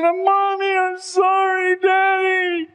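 high, slow sobbing cry to his parents; weeping boy. Retold or imitated. Preacher voicing a boy who killed his parents: high weeping cry. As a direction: imitate a boy crying out his sorrow. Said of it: "he's weeping bitterly".

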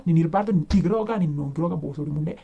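ordinary talk, nothing marked

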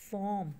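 फॉर्म loud, in a single flow; फॉर्म held whole without a break; one unbroken syllable. Shown as a demonstration. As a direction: call out फॉर्म as one beat. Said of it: "इसको आपने कहीं break नहीं किया".

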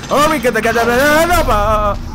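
loud high frantic stammer; panicked. Real, not acted. Panicked fumbling while chased.

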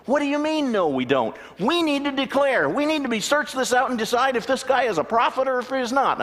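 high, loud indignant pleading; hammed-up outraged churchgoer; upset excited congregant. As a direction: protest in high agitation. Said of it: "he was upset with me".

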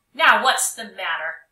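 loud impatient teacher; exasperated. Acted. Teacher annoyed at Tommy sitting with folded arms, loud. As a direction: snap the question.

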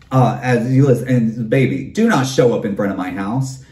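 ordinary talk, nothing marked

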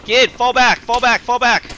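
urgent yelled retreat order; shouting orders over battle. Real, not acted. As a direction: yell it over gunfire. Calling teammate to retreat.

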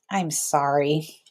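soft, slow sincere apology; mean every word of it; sincere sorry. A narrator's reading. Harry apologizing to his classmates, soft and sincere. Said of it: "Harry used his real "I'm sorry" voice".

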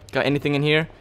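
ordinary delivery

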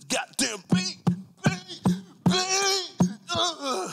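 mock panic. Gangster friend scared of a bee, hammed-up squeal. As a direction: squeal like a scared tough guy.

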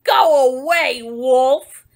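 loud, slow storybook shout, easing off; loud pig's retort, hardest at the start; yelled back. Acted. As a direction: holler the wolf away, stretching the words. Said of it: "He yelled back".

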